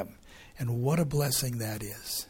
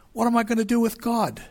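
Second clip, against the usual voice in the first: high-pitched question, dropping at the end; self-searching question; 8 semitones higher, 3 dB louder than usual. Acted. Preacher voices the question all of us face, high-pitched self-searching question. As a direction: pose it high, as everyone's own question.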